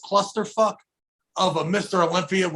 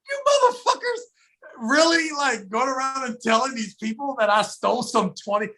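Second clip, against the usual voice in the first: outraged yelp; 5 semitones higher than usual. Retold or imitated. To the crew who blamed him, high outraged cry. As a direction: voice breaking with indignation.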